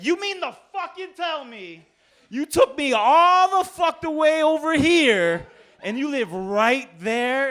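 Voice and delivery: high, loud, drawn-out disbelieving rant; outraged cop. Retold or imitated. Cop to passenger after pointless ride, outraged shouting. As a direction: explode in disbelief, stretching the words.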